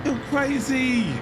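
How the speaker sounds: usual speaking voice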